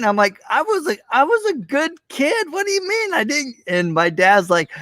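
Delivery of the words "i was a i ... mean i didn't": put-on much higher, tearful kid pleading; retold Christmas tears in a high child's voice; imitating a crying child. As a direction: replay yourself at eight, crying over no present. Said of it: "and I just started crying".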